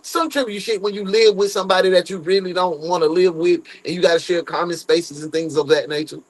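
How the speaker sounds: usual speaking voice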